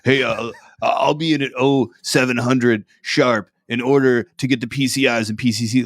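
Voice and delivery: overly formal military talk; mock military speak. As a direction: parody an eager recruit talking like a soldier. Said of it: "speaking in military stuff".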